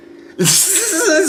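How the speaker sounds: a sudden, high-pitched burst of laughter; high squealing laugh. Real, not acted. Laughing at own story.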